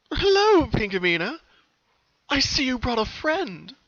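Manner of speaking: high, loud, slow sugary greeting; old colt, smiling. Acted. The old colt greeting the sisters, sugary. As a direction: welcome them a bit too sweetly. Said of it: "the old colt said with a smile".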